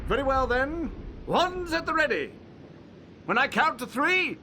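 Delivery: high, projected duelling announcement; calling the start. Acted. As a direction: call it out to the duellists. Teacher calls out duel start.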